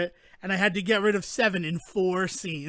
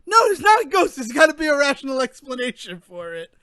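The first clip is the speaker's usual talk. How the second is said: emphatic insistence; 5 semitones higher, 6 dB louder, faster than usual. Retold or imitated. Arguing against blaming ghosts.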